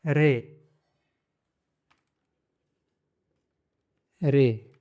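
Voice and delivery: रे said short with a tapped r; रे as the Spanish "re"; Spanish letter name. Shown as a demonstration. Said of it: "For example". (Spanish में "रे" जैसा उच्चारण)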